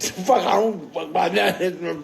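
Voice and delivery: ordinary delivery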